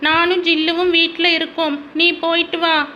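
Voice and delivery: put-on high, insistent little boy; stubborn child. Son refusing to leave without his dog, stubborn child.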